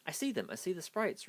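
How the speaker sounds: high, thrilled discovery; excited cry. A narrator's reading. Rudolph telling Zoe he sees the sprites, excited. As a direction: shout it the moment you spot them. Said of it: "Rudolph cried".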